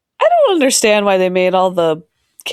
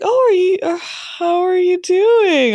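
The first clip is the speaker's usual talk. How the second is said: high, slow sing-song greeting; high, drawn-out fake-polite hello; polite stranger; 10 semitones higher than usual. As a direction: mimic an overly polite host. Family greets sweaty speaker.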